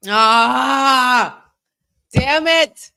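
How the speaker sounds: high, loud curse at the result; frustrated outburst. Real, not acted. Fan cursing the match result, loud frustrated outburst. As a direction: spit out the frustration. Wrestler loses.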